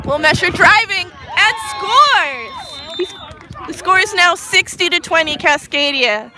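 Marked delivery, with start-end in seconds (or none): 0.0-3.1 shouted, slow goal call; excited goal call. Real, not acted.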